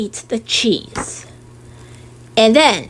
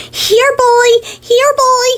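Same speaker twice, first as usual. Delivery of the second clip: high, louder call for the dog; 11 semitones higher, 4 dB louder, slower than usual. Acted. Child calling the dog across the dell, high call. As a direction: shout it like calling a puppy over.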